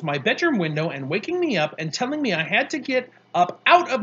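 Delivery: loud, high, relentless scolding; indignant dressing-down, pushed loud; angry tirade. A narrator's reading. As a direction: list his offences, hammering the repeated word.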